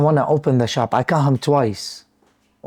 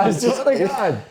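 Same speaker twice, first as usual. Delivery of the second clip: laughing; 6 semitones higher than usual. Real, not acted. Laughing while praising friend.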